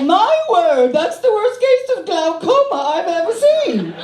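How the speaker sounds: British lady. Retold or imitated. Passenger in front row remarks in mock British accent. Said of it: "she was British".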